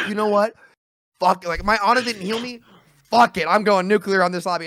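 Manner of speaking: imitated rage-quit rant; mock gamer tantrum. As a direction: act out a player abruptly losing it. Acted player giving up in anger.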